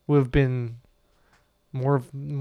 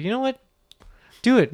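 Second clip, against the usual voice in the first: mock high quote; 9 semitones higher than usual. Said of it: "I'd be like".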